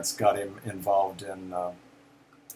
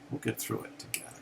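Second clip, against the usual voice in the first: soft, almost whispered promise; gentle reassurance; 9 dB quieter, faster than usual. Real, not acted. Speaker reassuring listeners, hushed and gentle. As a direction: say it tenderly to the listener. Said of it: "there's a lesson in that for all of us".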